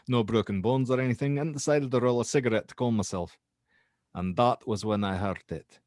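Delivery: lightly put-on Scottish accent as the witness; an understated Scottish brogue. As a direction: tell it as a Scottish student, casual Scottish accent. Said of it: "I'm gonna do a frickin' Scottish accent".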